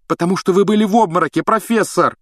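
high, fast, loud retort; heated objection. A narrator's reading. Hermione contradicting her professor, heated. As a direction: object hotly and quickly. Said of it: "горячо возразила Гермиона".

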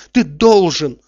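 worked-up, emphatic demand; agitated insistence. A narrator's reading. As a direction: insist loudly, pressing the point. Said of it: "в волнении торопил его Обломов".

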